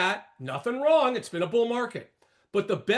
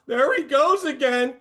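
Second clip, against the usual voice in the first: higher, louder imitation of grumbling viewers; exasperated quote, pitched up; mock complaint; 5 semitones higher, 5 dB louder than usual. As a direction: mimic a fed-up heckler. Voices viewers fed up with him.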